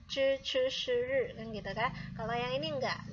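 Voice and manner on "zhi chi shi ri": slow "Zhi chi shi ri" with a curled tongue; slow, loud "Zhi chi shi ri"; retroflex zh ch sh r. Shown as a demonstration. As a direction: curl the tongue back on each sound of "Zhi chi shi ri". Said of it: "ada er-ernya gitu".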